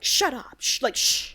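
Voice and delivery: urgent mock shushing; acted hushing. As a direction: shush a friend who's saying too much. Priest hushing his friend, acted.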